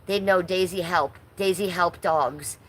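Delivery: usual speaking voice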